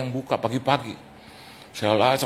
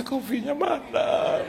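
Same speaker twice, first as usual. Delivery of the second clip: high, drawn-out mock whine; soft, squeaky wail of impatience; inner plea; 12 semitones higher, 5 dB quieter, slower than usual. Pastor silently pleading for his coffee, mock whine. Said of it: "Dalam hati saya".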